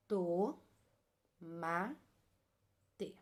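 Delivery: To, ma, te with long pauses between; syllable by syllable. Shown as a demonstration. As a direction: sound out To... ma... te one piece at a time. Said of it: "Então, vamos lá".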